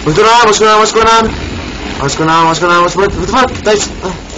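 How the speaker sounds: real terror at a jump scare; panicking gamer. Real, not acted. Jump scare in Slenderman game.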